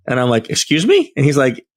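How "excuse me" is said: shocked high-pitched disbelief; a sudden, much higher startled question; surprised. Retold or imitated. Cop says he knows them.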